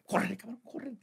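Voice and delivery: high soft urgent whisper; hushed urging. Retold or imitated. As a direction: rush him out quietly. Wife urging him, hushed. (esposa apurándolo en susurro)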